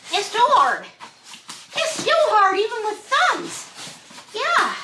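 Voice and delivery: high-pitched talk to a pet; high, coaxing little complaint. Real, not acted. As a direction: plead lightly in a high voice. Talks to pet about thumbs while straining.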